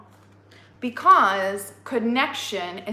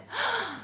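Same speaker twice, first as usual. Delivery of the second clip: gasp; 12 semitones higher, 8 dB quieter than usual. A narrator's reading. Discovering Eros by candlelight.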